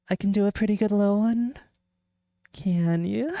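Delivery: put-on high, cackling old hag voice. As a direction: play a witchy old crone. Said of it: "Oh, well, hag voice".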